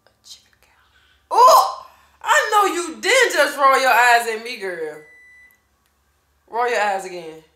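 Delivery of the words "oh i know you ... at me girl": loud laughing mock indignation; mock outrage. Mom pretending to be offended by her daughter's eye roll.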